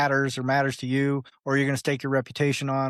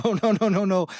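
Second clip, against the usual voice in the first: alarmed coder; 5 semitones higher than usual. Acted. Coder stopping a user from launching a bad app, acted alarm. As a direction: jump in to halt a disaster, playfully overdone. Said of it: "some coder would come along in two seconds and go".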